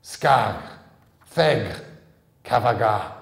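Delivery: loud, forceful incantation in an ancient tongue; ancient ghoul words. A narrator's reading. The boy commanding the ghoul gate, shouted incantation. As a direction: call out each word like a spell. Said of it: "he said three words in a language that was already ancient".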